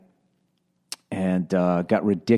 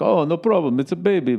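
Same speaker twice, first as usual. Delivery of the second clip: higher, breezy brush-off; imitated easygoing passenger; 7 semitones higher than usual. Passenger to apologizing dad, imitated breezy brush-off. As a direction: wave off the apology lightly, pitched up.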